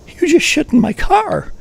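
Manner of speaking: high, slow, whiny disbelief; stunned driver. Retold or imitated. Driver whining at the hitchhiker, high slow disbelief. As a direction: voice the driver as a wounded kid. Driver quoted, shocked, jowls quivering.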